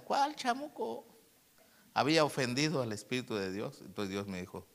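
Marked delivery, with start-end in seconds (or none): 0.0-1.1 high, drawn-out incredulous question; mock disbelief. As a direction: scoff at the idea, slow and high-pitched. Mocks his own rebuke of devil. (incredulidad burlona, aguda y alargada)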